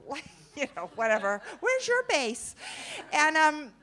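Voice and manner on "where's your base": high-pitched imitated small talk; chirpy colleague. Stewardess asking a supposed co-worker, chirpy.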